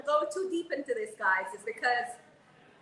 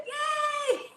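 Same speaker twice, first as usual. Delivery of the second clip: excited yell; 10 semitones higher, slower than usual. Retold or imitated. Woman spotting a lookalike in a salon, excited high shout. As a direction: blurt it out with pure joy. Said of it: "I was so excited".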